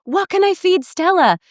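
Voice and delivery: high, anxious mock teacher voice; the panicked teacher asking what to feed; frantic teacher. Teacher frets to mom about feeding Stella, high and anxious. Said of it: "she's like".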